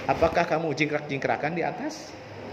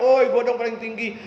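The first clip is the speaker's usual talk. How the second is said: bragging yell; 5 semitones higher, 3 dB louder than usual. Retold or imitated. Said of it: "Dia teriak-teriak".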